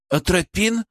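a touch of fright in the offer; frightened suggestion. A narrator's reading. Young medic suggests a drug, frightened. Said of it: "испуганно предложил Петров".

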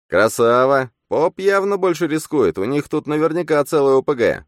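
a shrill mocking inner voice; smirking double. A narrator's reading. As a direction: cheer him on with a smirk. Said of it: "усмехнулся Фантом".

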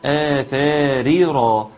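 آثار with the long madd, ra heavy; heavy ra demo. Shown as a demonstration. Teacher showing the madd in آثار, slow. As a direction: draw out آثار and make the ra heavy. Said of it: "المد المنفصل خد بالك منه".

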